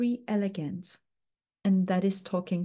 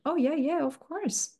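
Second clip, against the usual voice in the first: client agreeing; 8 semitones higher than usual. Retold or imitated. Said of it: "the client will go like".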